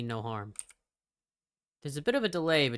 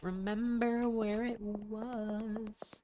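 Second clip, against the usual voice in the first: nervous high murmur; 9 semitones higher, 3 dB quieter, much slower than usual. Real, not acted. Lost, nervous player alone.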